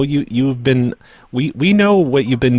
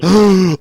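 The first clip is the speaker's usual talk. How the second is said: gasp; 6 semitones higher, 5 dB louder than usual. Pretend. Caller caught, mock alarm.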